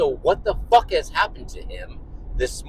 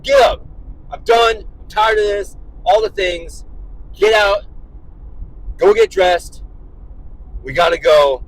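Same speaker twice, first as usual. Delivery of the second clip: loud, raised-pitch retold dad yell; 3 semitones higher, 4 dB louder than usual. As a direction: fire off fed-up orders at full voice. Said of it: "You know, I started yelling".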